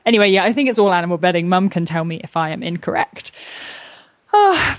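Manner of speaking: ordinary delivery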